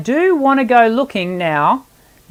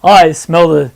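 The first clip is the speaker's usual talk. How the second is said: low, louder giant rumble; 5 semitones lower, 5 dB louder than usual. Shown as a demonstration. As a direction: sound big and deep like a giant. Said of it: "the giant from Jack and the Beanstalk".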